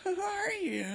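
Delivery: put-on high, thin little girl's voice; a frail child straining to hear; sick little girl.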